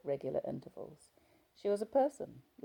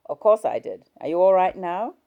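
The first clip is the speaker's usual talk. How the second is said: husband voice; 4 semitones higher, 14 dB louder than usual. Acted. Husband after quarrel.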